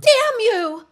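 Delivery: high, loud furious cry; furious outburst. Acted. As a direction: spit it at him in rage. Secret exposed, she lashes out.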